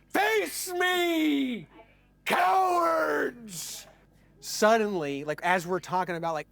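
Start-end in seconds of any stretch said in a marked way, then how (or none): 0.1-3.8 long, high-pitched taunting yell; drawn-out holler across a parking lot; crazed challenge. Retold or imitated. Knife man challenging the hotel guests, imitated high holler.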